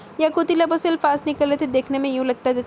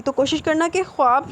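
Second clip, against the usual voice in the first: choked up; 5 dB quieter than usual. Acted. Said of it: "ان کا لہجہ بھرا گیا".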